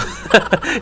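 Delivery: short airy laugh under the breath; breathy laugh. Real, not acted. Vlogger laughing at his own mispronunciation, airy chuckle. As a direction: laugh at your own slip.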